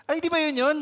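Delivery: high, loud surprised question; eager surprise. Acted. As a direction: sound pleasantly surprised. Cheerful parent asks. (masayang pagkagulat)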